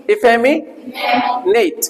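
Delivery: "इफेमिनेट" very slowly and carefully; teacher's slow model word; model pronunciation. Shown as a demonstration. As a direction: drag out "इफेमिनेट" for repeating. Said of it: "इस पे stress लगा हुआ है".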